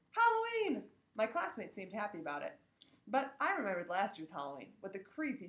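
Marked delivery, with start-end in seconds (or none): 0.1-0.9 high, loud excited yell; child cheering; excited shout. Acted. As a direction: shout the holiday out gleefully. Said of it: "everyone else started shouting".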